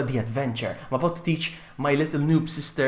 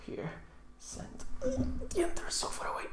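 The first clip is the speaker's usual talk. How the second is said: whisper; 16 dB quieter than usual. Real, not acted. Muttering to self before stream.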